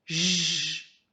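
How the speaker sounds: J as a long buzzing French "zh"; isolated J sound. Shown as a demonstration. Teacher shows a child the sound, J drawn out as "zh". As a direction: hold J as one sustained "zh" consonant. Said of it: "tu fais".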